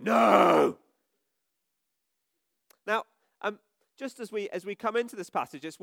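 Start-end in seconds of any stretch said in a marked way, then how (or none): 0.0-0.8 loud flat protest; booing crowd. Retold or imitated. Vicar playing the angry crowd against Jesus, loud. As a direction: shout it down like the other side. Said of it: "others who were going".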